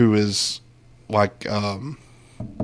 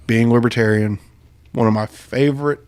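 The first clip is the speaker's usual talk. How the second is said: usual speaking voice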